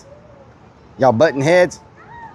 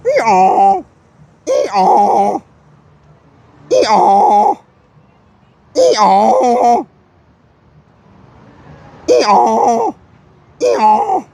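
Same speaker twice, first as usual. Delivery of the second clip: loud high hee-haw bray; donkey bray; 7 semitones higher, 4 dB louder, much slower than usual. Retold or imitated. Braying at the donkey to get a reaction, loud. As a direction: bray like a donkey at full volume. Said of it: "that's not how we talk".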